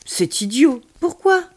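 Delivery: put-on high, loud, urgent kid voice; worried child character. A narrator's reading. Child realising it is late for grandmother, anxious.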